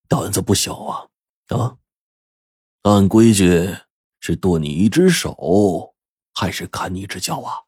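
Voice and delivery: menacing gang boss. Acted. Boss threatens kneeling Zhang Jun.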